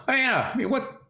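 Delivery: hammed-up courtroom protest; mock attorney. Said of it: "You sound like my attorney in Mad Court".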